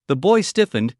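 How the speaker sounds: ordinary delivery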